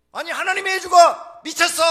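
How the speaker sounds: very loud, high scolding yell; acted angry shout. Luther scolding his weeping wife, acted outraged shout.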